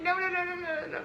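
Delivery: rapid, high string of noes; playful retold alarm; mock panicked refusal. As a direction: back away with quick, high protests. Retelling backing away from approaching man.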